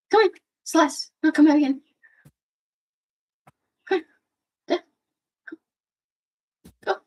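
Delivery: high coaxing call; calling the cat. Real, not acted. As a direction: coax Celeste back inside.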